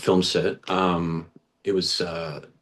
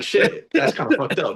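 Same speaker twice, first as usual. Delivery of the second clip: high mock shock. Inner reaction retold, high.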